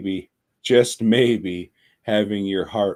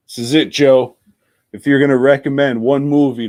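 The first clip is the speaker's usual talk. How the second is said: louder, higher, driving the point home; emphatic; 6 semitones higher, 9 dB louder, faster than usual. Real, not acted. Closing his pick to co-host Joe, punched out loud. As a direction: slam it down like a verdict.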